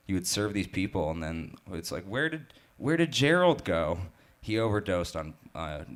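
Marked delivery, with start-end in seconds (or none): 2.0-4.0 put-on high, quavering old person asking; confused old resident. Waiter imitating an old resident, high and confused. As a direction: play a lost old-timer at the home.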